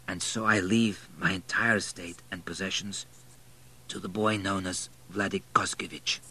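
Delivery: softly voiced formal bequest; a hint of a failing old nobleman; quiet, grave declaration; dying baron, solemn. A narrator's reading. Dying Baron names his heir.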